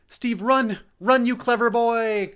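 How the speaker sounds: high, very loud yelled warning; desperate shout. Acted. Ash yells for Steve to flee the monster, desperate shout. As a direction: scream at him to run.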